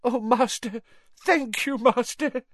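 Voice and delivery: high, sobbing gratitude; groveling servant. Acted. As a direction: whimper your thanks. Wormtail begs his master.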